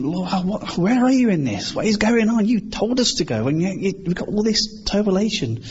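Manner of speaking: exasperated questioning, pitched well up; bewildered plea. Acted. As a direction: protest in acted bewilderment. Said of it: "those moments of, of just total".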